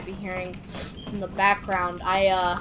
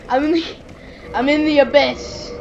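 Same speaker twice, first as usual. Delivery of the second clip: loud, raised-pitch mock drama; hammed-up announcement; 6 semitones higher, 6 dB louder than usual. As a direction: proclaim it with playful grandeur. Hammed-up repeat of being in abyss.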